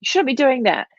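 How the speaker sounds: high, scolding owner voice imitated; mock frustrated owner. Mocking owners who scold their biting puppy, high nagging voice. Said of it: "so you start".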